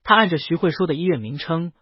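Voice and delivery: ordinary delivery, nothing marked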